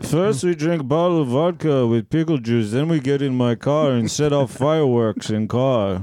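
lightly put-on Russian accent; broken English, mock Russian. Mock Russian man bit.

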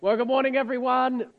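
hoarse from a cold, voice cracking; rough, croaky voice getting over a cold. Real, not acted. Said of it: "Do excuse my croaky voice".

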